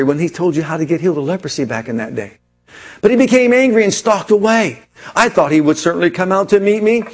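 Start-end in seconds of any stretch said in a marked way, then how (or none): none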